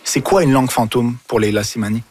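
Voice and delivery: ordinary talk, nothing marked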